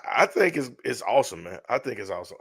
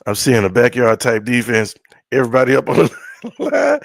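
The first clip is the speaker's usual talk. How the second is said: calling out the defense louder, half laughing; loud mock play call; 9 dB louder than usual. Fan shouting what he'd say watching the draft, loud and amused. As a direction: bark it like a sideline call, laughing at the end. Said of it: "about to say".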